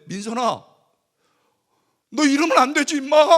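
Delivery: loud, high pleading scold; tearful scolding. Retold or imitated. Pastor tearfully scolding wayward student, loud rebuke. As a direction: scold her while near tears.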